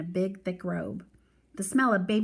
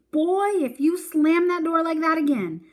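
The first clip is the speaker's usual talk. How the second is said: higher, louder angry yell; yelling grandmother; 8 semitones higher, 8 dB louder than usual. A narrator's reading. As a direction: yell after the slammed door. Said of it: "Grandma Sands yelling".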